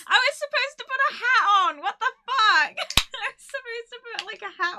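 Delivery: very high, loud sudden realisation; dismayed outburst. Real, not acted. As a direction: shriek it as something forgotten suddenly comes back. Suddenly remembers the forgotten party hat.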